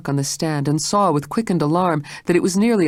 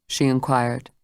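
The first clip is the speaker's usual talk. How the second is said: ordinary delivery, nothing marked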